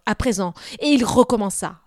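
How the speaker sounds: plain reading voice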